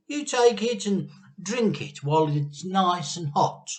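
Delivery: high, sugary coaxing; sweet Mister Cranky. A narrator's reading. Mister Cranky sweetly urges Grandma to drink. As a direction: coax her sweetly, hiding the trap. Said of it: "he smiled sweetly".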